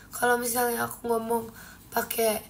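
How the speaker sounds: usual speaking voice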